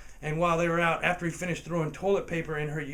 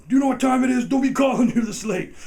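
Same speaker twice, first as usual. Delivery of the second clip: loud, high mock outrage at the caller; fake annoyed yell; 9 semitones higher, 11 dB louder than usual. Kid faking an angry grown-up on the phone, loud. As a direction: bark it like a grumpy adult woken up.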